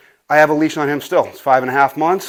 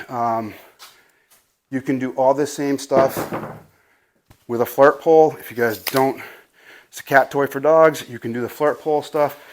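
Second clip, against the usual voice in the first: normal talking voice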